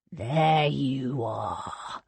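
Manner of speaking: low, drawn-out snarl. A narrator's reading. Thomas cornering cousin Cornelius, snarling. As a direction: snarl it slowly, dropping low. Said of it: "he snarled".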